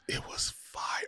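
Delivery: breathy hushed whisper. Real, not acted. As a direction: drop to a whisper. Hushed aside about the show.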